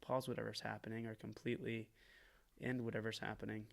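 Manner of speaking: mock earnest confession. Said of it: "literally just being like".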